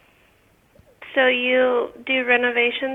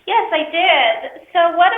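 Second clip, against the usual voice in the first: distant speakerphone voice, raised in pitch; 5 semitones higher, 3 dB louder, slower than usual. Real, not acted. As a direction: talk up into a speakerphone from across the room. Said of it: "You're, like, way off in the background".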